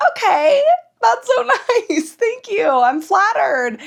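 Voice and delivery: high-pitched, awkwardly sweet gushing; acted flattered thanks. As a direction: squeal polite thanks at an admirer. Acts out polite thanks to admirer.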